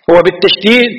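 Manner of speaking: ordinary talk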